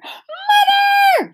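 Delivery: high, loud cry of horror; horrified cry. A narrator's reading. Girl finds the ruined cake in the oven, horrified high cry. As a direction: scream for Mother at the sight. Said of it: "she cried, horrified at what she saw".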